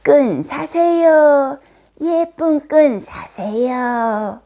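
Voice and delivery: slow, drawn-out peddler's cry; singsong street-seller shout; loud vendor's call. A narrator's reading. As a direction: hawk your wares in long, high calls. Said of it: "큰 소리로 외쳤어요".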